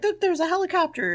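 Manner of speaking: high-pitched excited imitation; mock-excited, high voice; retold excitement. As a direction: pitch it up like an excited companion spotting something. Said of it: "she's very excited".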